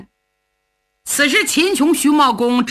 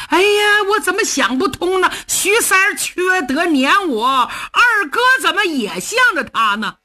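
higher, aggrieved cry; bitter lament; 9 semitones higher than usual. Acted. Cheng lamenting alone on his horse. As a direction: wail at the unfairness.

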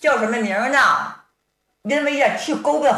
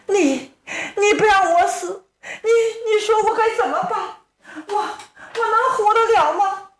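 put-on high, stammering, tearful young woman; stuttering through guilt and tears, pitched high; distraught girl; 11 semitones higher than usual. Sister arguing with Hua Yunping in high stammering tears.

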